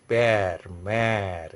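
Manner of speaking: "pear, mare" with long, open eh vowels; flattened vowel. Shown as a demonstration. French teacher demonstrating the sound to students, flattened vowel. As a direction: stretch "pear, mare" out with spread lips. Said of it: "your lips are expanding".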